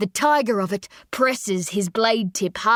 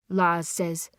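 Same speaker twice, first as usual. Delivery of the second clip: usual speaking voice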